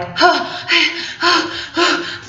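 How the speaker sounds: long, high, ragged gasping; retold panic gasps, breathless and high; panicked gasps. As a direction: gasp like you just fled a room. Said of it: "I was freaked out".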